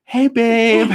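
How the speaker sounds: hammed-up happy wife voice; sweet wife. Husband voicing wife in the redo, high sugary greeting. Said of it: "Angela will go".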